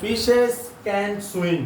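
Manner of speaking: extremely slow, every word drawn out; slow dictation. Shown as a demonstration. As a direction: read the sentence out slowly for students to copy. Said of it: "প্রথমে বাক্যটা আসে". (খুব ধীরে শ্রুতলিপির মতো পড়া)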